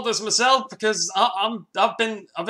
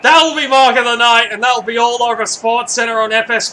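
hyped call; 5 semitones higher, 8 dB louder than usual. Real, not acted. Hyping the mark of the night.

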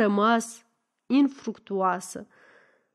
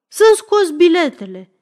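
loud, high angry demand; grinding fury; 6 semitones higher, 8 dB louder, faster than usual. A narrator's reading. Lefter demanding his tickets from the women, furious order. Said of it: "scrâșnește domnul Lefter".

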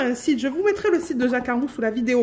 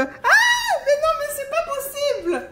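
high-pitched squeal of real surprise; excited shriek; 13 semitones higher than usual. Real, not acted. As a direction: shriek with joy at the gift. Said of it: "j'ai gueulé". (cri de surprise aigu et joyeux)

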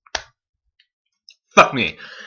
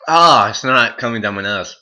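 ordinary delivery

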